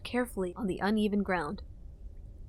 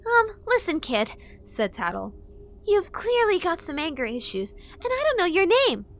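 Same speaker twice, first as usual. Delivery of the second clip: put-on fairy girl voice; 9 semitones higher, 4 dB louder than usual. A narrator's reading. Fairy scolding.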